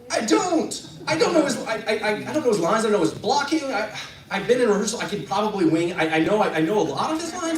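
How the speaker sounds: high, loud, stammering alarm; flustered, stumbling over yourself; acted panic. Young actor asked to go on unprepared, panicking. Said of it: "'cause I'm starting to panic".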